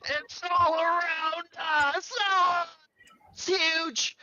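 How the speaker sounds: very high, drawn-out panicked scream. Acted. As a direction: scream it as a character overcome by a vision. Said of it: "John begins to scream".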